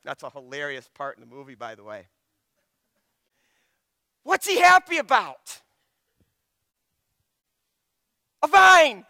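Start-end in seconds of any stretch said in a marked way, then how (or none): 4.2-9.0 high, loud scoff at a silly joy; mocking disbelief. Real, not acted. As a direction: make the vine sound ridiculous. Mocking Jonah's joy over a vine.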